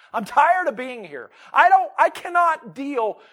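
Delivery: loud, high cry of exhaustion; weary sinner's plea. Pretend. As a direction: plead it like someone at the end of their rope. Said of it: "this is a picture of repentance".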